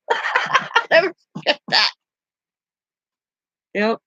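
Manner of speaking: real laughter through "I'll never forget that"; laughing. Real, not acted. Laughing at grandpa's retort.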